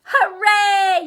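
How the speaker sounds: loud, high cheer; yelling. Acted. The audience yells its approval, loud and high. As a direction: cheer like a whole crowd. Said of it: "yelled the audience".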